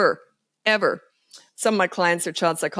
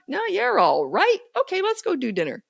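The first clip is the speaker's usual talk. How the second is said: breezy parent; 11 semitones higher than usual. Retold or imitated. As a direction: shrug it off cheerfully. Parent voiced after tantrum.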